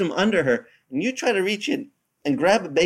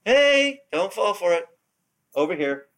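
sudden higher, louder "Hey"; urgent warning call; 6 semitones higher, 3 dB louder than usual. Retold or imitated. The Lord warning people not to fall for evil, urgent and higher. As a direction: snap them out of the trap. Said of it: "And the Lord's going".

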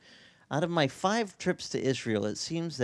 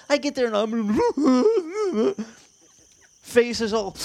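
laughing; 10 semitones higher, 5 dB louder, much slower than usual. Real, not acted. Laughing at his own misery.

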